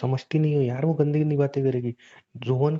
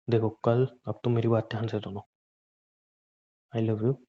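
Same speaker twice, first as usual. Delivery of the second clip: ordinary delivery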